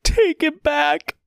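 slow, high tearful plea; weeping wish; sobbing. A narrator's reading. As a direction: stretch it out through tears. Sobbing apology to the child.

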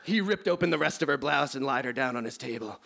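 normal talking voice

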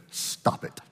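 soft whispered command; whispered rebuke. Retold or imitated. Elderly lady facing the possessed woman, whispered rebuke. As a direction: whisper it like a tiny old lady taking charge. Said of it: "this lady, she said".

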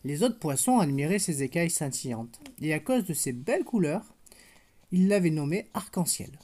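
put-on gentle storybook narration for a toddler; picture-book reading voice; bedtime story reading. A narrator's reading. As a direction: read it like a bedtime tale to a little girl. Reads bedtime story to child.

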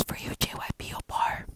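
sly, soft whisper. Pretend. To [name] after a burp, a sly whisper. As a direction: breathe it as a cheeky aside.

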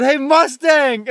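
loud, high, slow taunting call; mocking call. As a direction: stretch out the nickname to tease him. Mocking guard across parking lot.

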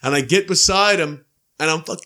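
usual speaking voice

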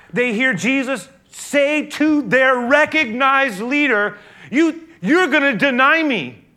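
loud, high, impassioned sermon build; full-force preaching. Acted. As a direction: preach it out to the whole room.

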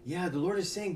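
ordinary delivery

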